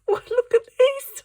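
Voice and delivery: high, squealing "oh, look at this"; delighted squeal. Real, not acted. Opening her friend's gift, squealing with delight. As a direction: gush over the cute gift.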